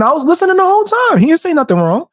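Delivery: high-pitched retold protest; imitated defence. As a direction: mimic a friend pleading his case, voice way up. Retells friend defending him in chat.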